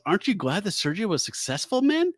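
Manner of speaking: lightly voiced kind doctor, rising high at the end; a hint of a cheery doctor's question; sweet doctor. Podcaster voicing the doctor to her cohost, a touch sing-song.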